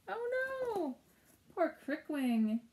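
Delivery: sudden high gasp of dismay; surprised. Real, not acted. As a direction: gasp it out in alarm. Teacher reacts to story.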